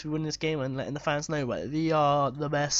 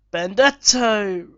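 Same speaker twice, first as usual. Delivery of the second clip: long drawn-out yell of the name, louder; excited shout; 4 semitones higher, 8 dB louder, much slower than usual. Real, not acted. Gamer roaring his striker's name at a shot. Near miss while playing.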